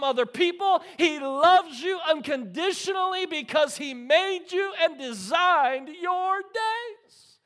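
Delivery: loud, rising sermon climax; preaching at full force. Acted. Pastor preaching love to the congregation, full force.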